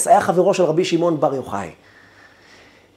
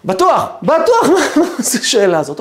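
laughing certainty; 7 semitones higher, 4 dB louder than usual. Retold or imitated. Wife answers with laughing certainty.

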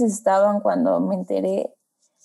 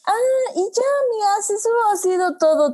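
high sign-off; 11 semitones higher than usual. Real, not acted. Wrap-up to listeners.

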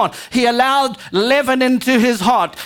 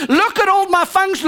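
put-on insistent older son; 6 semitones higher than usual. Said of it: "He's saying".

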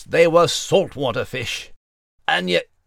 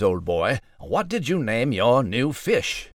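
ordinary talk, nothing marked

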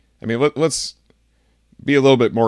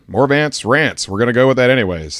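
loud mock announcement; 4 semitones higher, 5 dB louder than usual. Shown as a demonstration. Host gives up and loudly announces the segment title. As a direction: announce the title like a show opener, louder. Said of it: "making me mispronounce my name".

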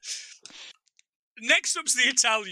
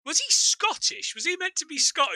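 surprised; 9 semitones higher than usual. Real, not acted. Surprised question.

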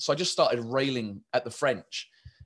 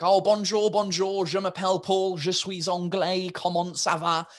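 high, exaggerated English accent on French; 6 semitones higher, 3 dB louder than usual. Comedian opening a French set as an Englishman, thick English accent. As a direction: put on a broad English accent in French, pitched up. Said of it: "in an English accent".

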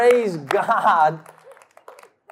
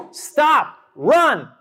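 acted sharp order, higher and louder; sudden command; 6 semitones higher, 4 dB louder than usual. God interrupts the excuses with a sudden acted command. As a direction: fire off each word as an urgent order.

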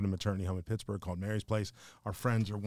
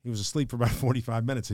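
ordinary talk

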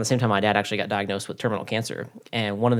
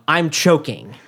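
raised, urgent yell of alarm; mock scream; 5 semitones higher, 4 dB louder, much slower than usual. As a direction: blurt it like a panicked kid in class. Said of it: "and scream".

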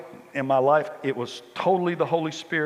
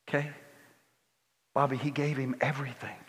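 whispered aside to Bobby; 5 dB quieter than usual. Real, not acted. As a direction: breathe it as a secret. Aside to a congregant.

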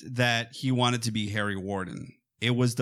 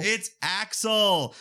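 slow, higher, drawn-out announcement; mock shocked reveal; 7 semitones higher, much slower than usual. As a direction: stretch out the culprit's name theatrically.

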